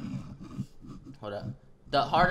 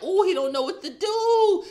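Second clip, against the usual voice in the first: high, loud teasing voice; mocking squeal; 13 semitones higher, 6 dB louder than usual. Taunting the helpless guy, high and loud. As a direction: sing it out high to mock him. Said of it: "I'm like".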